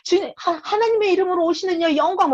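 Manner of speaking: high, excited mock acclamation; imitated joyful praise; praising followers. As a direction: cry out praise like the crowd, pitched high. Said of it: "막 이러니까".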